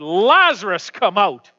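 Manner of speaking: loud commanding call to the dead. Acted. Jesus calling Lazarus from the tomb, loud command. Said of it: "in a loud voice, calls out".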